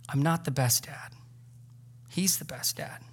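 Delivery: soft whisper, no voice; whispered tenderly. Shown as a demonstration. Dad whispering to his kid at bedtime. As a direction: breathe it gently like a secret. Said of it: "imagine if he regularly said".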